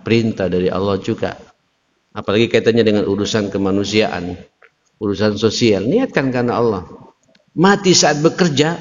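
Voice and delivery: normal talking voice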